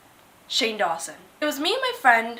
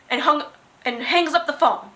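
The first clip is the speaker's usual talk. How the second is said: ordinary talk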